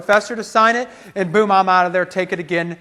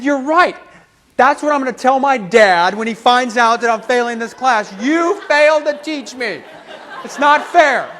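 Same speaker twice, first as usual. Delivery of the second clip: high-pitched mock indignation; indignant protest; 4 semitones higher than usual. Student blames professor for failing him, indignant. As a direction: protest the injustice with raised pitch.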